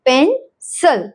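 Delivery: पेंसिल slowly as pen-səl; slow split पेंसिल, schwa in the last syllable. Shown as a demonstration. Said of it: "आई की sound यहाँ पर है अह". (पेंसिल धीरे-धीरे तोड़कर, शवा के साथ)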